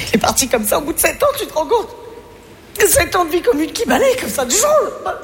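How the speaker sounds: rising high, tearful wail; mock weepy breakup. An adult lamenting her breakup, playing a tearful child. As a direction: whimper it out like a heartbroken child.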